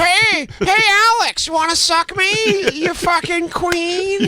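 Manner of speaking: high put-on sneering voice, slowing at the end; mock bully voice. Host voices the bully taunting a castmate, high and sneering. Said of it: "He goes".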